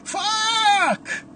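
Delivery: very high, long agonized scream. A narrator's reading. Andy screams as the knife goes in, high agonized scream. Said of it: "Andy screams".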